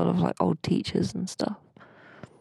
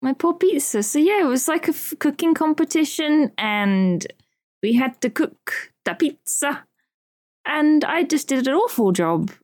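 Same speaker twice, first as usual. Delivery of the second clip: hammed-up woe; 7 semitones higher, 5 dB louder than usual. Hammed-up lament about the pizza.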